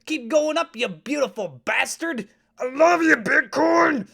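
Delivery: high, loud shouts of adoration; hyped-up cheering. Pretend. Host cheering Bitcoin's price upward, ecstatic shouting. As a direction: yell it like a wild fan.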